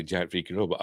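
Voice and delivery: ordinary delivery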